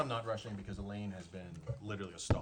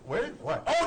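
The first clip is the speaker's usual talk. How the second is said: normal talking voice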